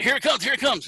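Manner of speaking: high-pitched eager aside; retold anticipation. Dad telling son the star is coming, retold excitement. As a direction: get excited as if the big moment is coming.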